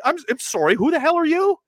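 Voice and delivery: mock-furious who the hell are you; indignant pushback. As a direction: tell them off in disbelief. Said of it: "they should have said".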